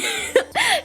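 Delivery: short high giggle; high breathy giggle. Real, not acted. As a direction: giggle softly. Laughing at the story.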